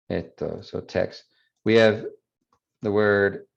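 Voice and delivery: ordinary talk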